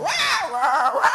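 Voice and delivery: high kid's "wah" shouts while playing; childish play noise. Little brother playing in the garden, high childish wah shouts. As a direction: cry "wah, wah" like a little boy with fantasy powers. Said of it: "legede med sine hænder og sagde".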